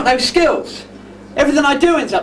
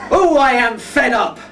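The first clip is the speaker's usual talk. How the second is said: ordinary delivery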